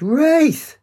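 high drawn-out call; crying out. A narrator's reading. Katie catching Rafe up from behind, crying out. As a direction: call out loudly to catch someone walking off. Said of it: "Katie cried".

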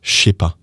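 "je sais pas" run together as "shay pa"; casual French "shay pa"; slang contraction. Shown as a demonstration. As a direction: drop the vowel of "je" and merge "je sais" into a "sh" sound. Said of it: "that becomes".

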